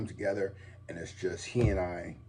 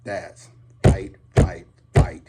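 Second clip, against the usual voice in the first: fervent rallying call; 2 semitones lower, 9 dB louder, slower than usual. Real, not acted. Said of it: "getting all passionate".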